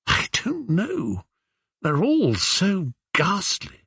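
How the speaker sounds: high, soft, slow lament; despairing. Acted. Penelope dreading the choice among ghastly suitors, despairing. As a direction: confess helpless dismay, drawn out.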